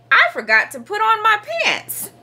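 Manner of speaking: put-on high, dismayed kid's voice; high-pitched child-frog confession; Froggy crying out. A narrator's reading. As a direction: admit it in a high cry. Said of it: "cried Froggy".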